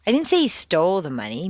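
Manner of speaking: "stole" emphasized and lengthened; stress on STOLE. Shown as a demonstration. Teacher implying he just borrowed it, stress on "stole". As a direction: punch "stole" to question that verb. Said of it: "Maybe he just borrowed it".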